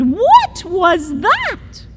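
high, slow wondering voice; puzzled kitten. Acted. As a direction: ask it slowly, baffled.

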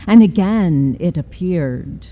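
plain reading voice

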